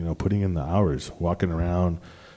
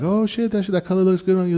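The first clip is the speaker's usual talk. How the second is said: much higher, impressed coworker voice; imitating coworkers; 11 semitones higher than usual. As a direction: squeak out the compliment like the coworkers did. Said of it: "people are like".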